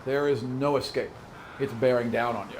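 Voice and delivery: ordinary talk, nothing marked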